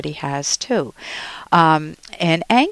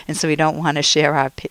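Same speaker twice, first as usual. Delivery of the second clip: ordinary talk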